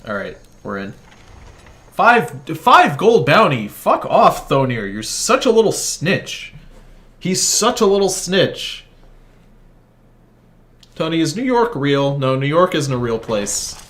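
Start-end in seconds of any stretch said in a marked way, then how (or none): 1.9-8.9 annoyed outburst. Real, not acted. Caught, bounty on his head.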